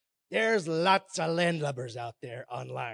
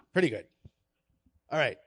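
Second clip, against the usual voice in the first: ordinary talk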